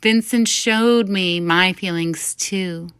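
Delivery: ordinary delivery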